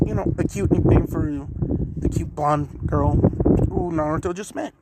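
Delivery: ordinary talk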